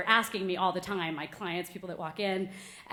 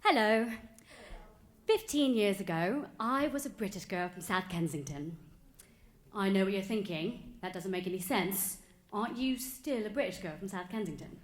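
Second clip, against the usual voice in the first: put-on British accent, measured delivery; badly faked London English; fake British accent. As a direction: play a British girl from South Kensington. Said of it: "with a fake British accent".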